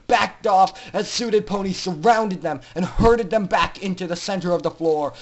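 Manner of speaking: usual speaking voice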